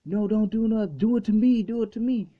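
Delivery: high, loud pleading shriek; screaming. Retold or imitated. Vegeta begging Beerus to hit him instead, screaming. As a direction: scream the offer to take the blow. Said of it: "just screaming".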